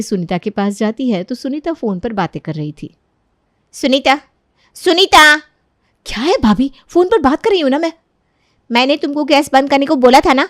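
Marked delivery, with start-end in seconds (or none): 3.7-5.4 second call much louder and sharper; angry call. Acted. Kaveri calls angrily. 6.1-10.4 higher, louder, fast irritated reply; annoyed girl. Acted. Quarrel on the phone.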